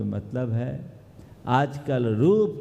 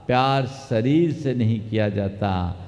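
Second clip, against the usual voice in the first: ordinary delivery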